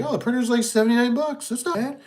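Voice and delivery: high, naive cheerful shopper; mock pleased. Printer buyer pleased with cheap price, high mock cheer. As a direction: sound pleasantly surprised by the price. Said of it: "you're like".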